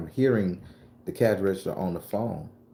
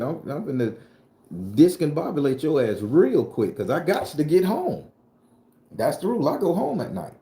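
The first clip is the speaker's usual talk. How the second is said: high, loud, worked-up rant; winding up into a comic threat; heated comic threat; 8 semitones higher, 3 dB louder than usual. Acted. Tells the audience his rule, high and heated.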